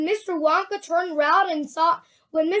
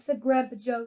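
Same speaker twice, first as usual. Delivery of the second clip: usual speaking voice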